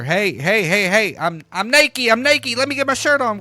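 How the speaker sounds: loud, high frantic shout; panicked shouting through the door; screaming. Retold or imitated. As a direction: scream to stop her coming in. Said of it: "I'm screaming at her".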